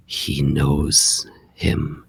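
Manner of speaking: breathless hushed whisper, slow; very quiet whisper. Acted. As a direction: barely breathe the words out. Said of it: "you hear him very quietly say".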